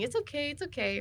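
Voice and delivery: gently calm a clinging friend; acted tender reassurance; reassuring. Character soothes clinging companion.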